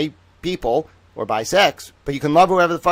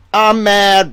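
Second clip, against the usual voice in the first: louder, higher hammed-up outburst; mock angry; 8 semitones higher, 9 dB louder than usual. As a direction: throw it out like a fake tantrum. Repeating I'm mad angrily.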